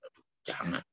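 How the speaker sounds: a hushed groan of warning; whispered aside. Real, not acted. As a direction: breathe it out, almost silent. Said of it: "whispered aside". (bisikan pelan)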